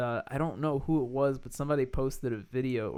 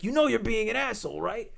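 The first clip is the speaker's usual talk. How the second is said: higher-pitched challenge in imitation of a TV host; mock confrontation; 8 semitones higher than usual. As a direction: imitate someone calling out bad behaviour. Acting out Taffer confronting the owner.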